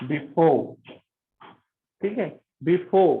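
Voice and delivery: "before" said as "bifo", r silent at the end; final r dropped. Shown as a demonstration. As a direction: drop the r in "before", end on a long o. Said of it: "जैसे example".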